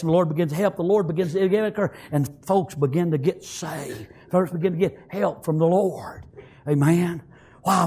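ordinary delivery

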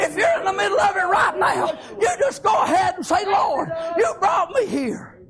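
high, driving shouted preaching; full-force preaching. Real, not acted. Preacher exhorting his congregation, full-force shouted preaching.

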